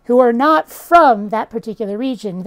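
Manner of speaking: ordinary talk, nothing marked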